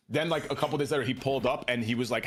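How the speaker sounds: ordinary talk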